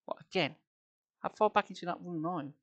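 ordinary delivery

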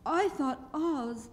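put-on high, puzzled girl's voice; Dorothy. A narrator's reading. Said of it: "said Dorothy".

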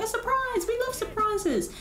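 high, small crowd voice; delighted villagers. Acted. Villagers reacting to a promised surprise, high and delighted.

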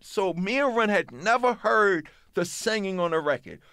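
ordinary talk

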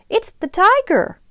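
high, loud storybook cry; cried alarm. A narrator's reading. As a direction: cry out the warning. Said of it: "Mama cried".

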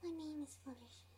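soft timid pony voice; shy Fluttershy voice. Acted. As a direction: say it shyly and softly. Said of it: "Fluttershy twenty sixteen".

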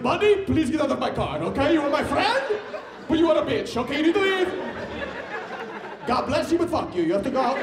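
put-on laughing Middle Eastern accent, politely furious; Middle Eastern driver impression. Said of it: "he was Middle Eastern".

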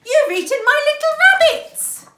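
high, loud outraged cry; doctor exclaiming at the wolf, loud and shocked. A narrator's reading. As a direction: shout it in shocked disbelief. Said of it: "The doctor cried".

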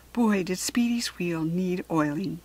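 ordinary delivery, nothing marked

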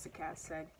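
ordinary delivery, nothing marked

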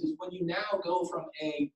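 ordinary delivery

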